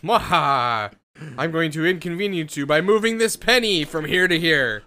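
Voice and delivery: cackling cartoon villain, loud and hammy; sneering panto villain. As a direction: ham it up like a petty evil mastermind. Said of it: "just as his".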